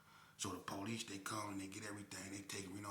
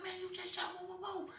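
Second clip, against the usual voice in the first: much higher frightened cries; terrified. Retold or imitated. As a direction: back off in fear. Accomplice terrified.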